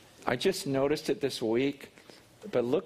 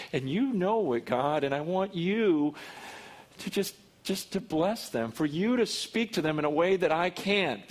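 fervent prayer; 4 semitones higher than usual. Acted. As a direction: pray aloud over the room, earnest. Pastor leads congregation prayer.

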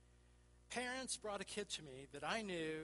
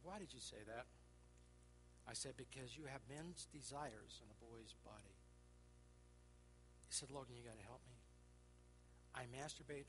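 put-on quiet, broken plea of a weeping boy; gently voiced tearful boy; 5 semitones lower, 10 dB quieter than usual. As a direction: soften into a crying boy's confession. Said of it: "He started crying".

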